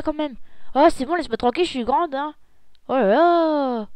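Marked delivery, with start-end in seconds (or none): 2.9-3.9 slow drawn-out oh là là; dragged-out exasperation; exasperated sigh. Acted. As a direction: roll your eyes through it. Exasperated by her sister.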